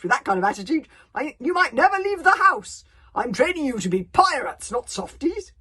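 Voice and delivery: loud, high scolding bellow; berating. Acted. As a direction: scold the boy like a drill leader. Gobber berates the timid boy.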